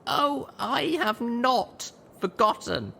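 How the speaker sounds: high, slow, bitter; bitter young mare. A narrator's reading. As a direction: let the grudge show. Littlepip bitter.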